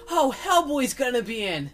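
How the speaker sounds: put-on child self. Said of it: "I was like".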